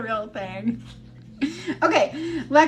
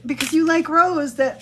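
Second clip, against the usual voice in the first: raised, louder gushing; excited mom; 5 semitones higher, 6 dB louder than usual. Retold or imitated. Mom explaining her outburst to family, mildly excited imitation. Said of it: "when she gets excited".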